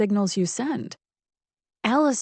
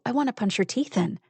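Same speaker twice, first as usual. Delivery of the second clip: ordinary delivery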